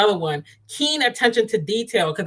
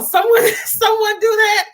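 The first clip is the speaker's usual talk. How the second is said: laughing, pitched way up in delight; excited disbelief; 12 semitones higher, slower than usual. Real, not acted. Gushing to her listeners about a helpful man, high laughing delight. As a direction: squeal at the thought.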